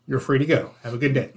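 imitating an officer backing down, low and soft; hushed retold dismissal. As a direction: quietly let the driver go, pitch dropped. Retold officer hastily letting driver off.

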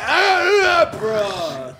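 overexcited bro yelp; high yelp then bro; frat bro. Pretend. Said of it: "he might just be like".

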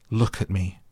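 defiant, understated order; flung-out command. A narrator's reading. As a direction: fling the words up at him. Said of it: "he flung up at Ben Weatherstaff".

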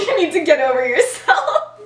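laughing. Retold or imitated. Friend teasing, laughing through line.

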